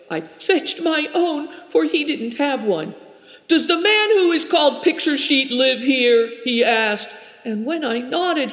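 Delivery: high, tense, breathless telling; a frightened girl blurting out what happened; upset young girl. A narrator's reading. Turakina's long upset account.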